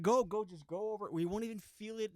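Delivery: high-pitched insistent backseat plea; retold urging. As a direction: push the driver to do it.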